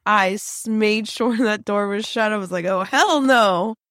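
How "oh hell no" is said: loud, slow, drawn-out refusal; punchy retold refusal; emphatic refusal. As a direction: stretch it out in firm, comic horror.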